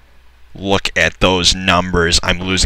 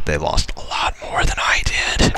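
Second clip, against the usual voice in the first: whispered, softer smug aside; 4 semitones lower, 7 dB quieter than usual. Real, not acted. Gamer confiding to viewers, whispered.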